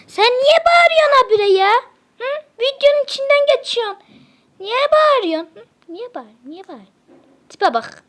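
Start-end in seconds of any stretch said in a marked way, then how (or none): 0.1-5.5 much higher voice, a mock-cute telling-off; high-pitched scolding. Real, not acted. Scolding the noisy one on camera, high-pitched mock scold. 5.5-6.9 muttered under breath. Real, not acted.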